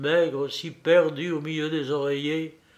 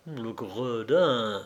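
slow, low suppressed anger; angry under breath; 4 semitones lower, much slower than usual. A narrator's reading. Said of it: "je ne pus retenir ma colère et à mi-voix".

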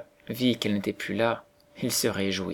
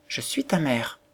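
plain reading voice

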